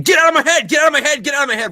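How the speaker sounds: repeated high shouted command, fading; screaming. Retold or imitated. Drill instructor storming into the head, screaming. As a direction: bellow it at the recruits. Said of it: "he comes in there just screaming".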